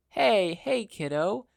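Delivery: put-on calm a frightened child; assuring. Hero kneels to a scared girl, reassuring and kind. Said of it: "gave her an assuring smile".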